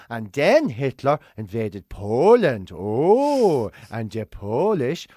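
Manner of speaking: put-on slow, low, sing-song storyteller for a small child; dad softening war history into a bedtime story for his kid; childish bedtime-story voice. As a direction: read it like a cosy bedtime tale, slowly. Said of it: "make them sound childish for the kid".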